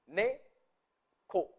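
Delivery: NEKO split into ne, ko with a long pause; syllable by syllable. Shown as a demonstration. Teacher showing learners the word for cat, syllable by syllable. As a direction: say NEKO as two separate syllables, ne ... ko.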